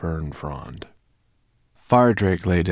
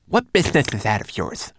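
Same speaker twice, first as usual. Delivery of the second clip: higher, louder little voice, bristling; defensive homunculus; 7 semitones higher, 6 dB louder than usual. Acted. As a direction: snap back at the nosy bird. Twigleg retorts to raven.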